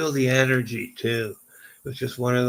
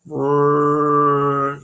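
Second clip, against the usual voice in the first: foghorn imitation; 3 semitones higher, 9 dB louder than usual. Streamer imitating the infrasound blast, loud foghorn rumble. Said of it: "Sound like a, a large foghorn".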